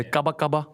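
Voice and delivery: kaba kaba with a high lift on the first kaba; Togolese kaba kaba. Shown as a demonstration. Showing friends how Togo says the phrase. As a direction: say kaba kaba like a local. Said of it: "So in Togo, you say".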